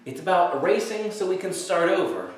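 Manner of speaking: ordinary talk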